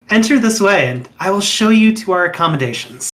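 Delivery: lightly voiced dashing host showing a guest inside; gracious young host. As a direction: usher the guest in with polished charm. Wraith host welcomes guest.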